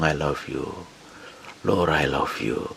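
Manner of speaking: quiet and slow, lingering on each word; slow hushed devotion; soft prayer. Shown as a demonstration. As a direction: say it slowly, softly, like an intimate confession.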